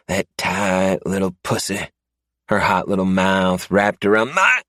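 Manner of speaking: starts high and loud, then settles into a sneering taunt; defiant captive man. Acted. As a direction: taunt him with the memory. A defiant gleam.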